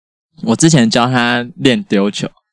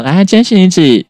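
teasing therapist; 8 semitones higher, 5 dB louder than usual. Retold or imitated. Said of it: "我就故意问他说".

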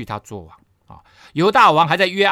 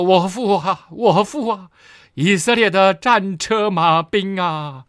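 louder, higher mock-weeping cry, slowing into long drawn-out words; 5 semitones higher, 5 dB louder, slower than usual. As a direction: call out in lament with stretched final words, acted rather than truly sobbing. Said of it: "伏在他脸上哭泣说". (拖长声音哭喊)

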